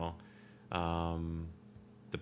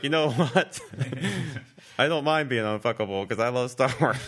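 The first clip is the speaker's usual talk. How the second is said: higher pitch, breaking into laughs; laughing mock declaration; 4 semitones higher, 3 dB louder than usual. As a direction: imitate defiant fans through laughter. Acting out fans' replies while laughing.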